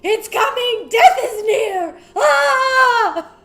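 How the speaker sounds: frantic, high screaming cry of doom; panicked shout. Acted. The Winter King bursts out screaming at the crew, panicked shout. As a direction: howl it like a terrified old king. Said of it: "He's shouting".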